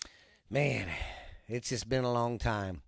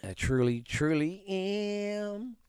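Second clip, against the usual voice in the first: mock sincere; 8 semitones higher, slower than usual. Mock heartfelt apology.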